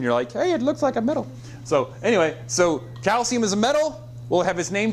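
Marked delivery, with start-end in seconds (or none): 0.3-1.3 high mock-student voice; reacting student. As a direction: imitate a pupil making a discovery. Voices students reacting last year.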